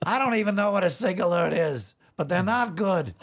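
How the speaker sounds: high-pitched cartoon king as anchorman; Ice King. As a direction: give the news as a hapless cartoon villain, pitched high. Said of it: "if the Ice King were delivering the".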